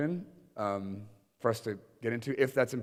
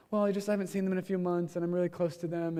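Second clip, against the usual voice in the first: lightly imitated intern, higher; 7 semitones higher than usual. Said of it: "She's like".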